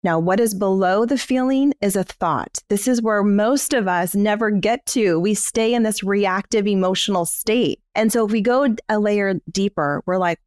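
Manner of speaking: ordinary delivery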